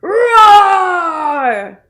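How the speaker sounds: put-on loud high roar for kids to copy. Shown as a demonstration. Narrator inviting kids to roar together, loud. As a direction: roar along with the children. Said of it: "Can you roar with me?".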